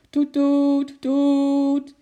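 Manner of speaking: loud, high, sustained honks; toeter nadoen. A narrator's reading. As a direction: imitate a blaring car horn. Toeter scheurt door de avond. (hard en hoog getoeter)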